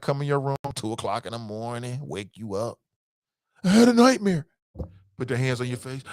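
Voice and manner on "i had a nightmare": put-on higher, louder kid voice; child waking a parent. Kid at parent's bedside at night, higher and urgent. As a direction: play a kid crying out from a bad dream.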